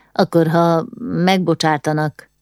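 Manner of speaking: usual speaking voice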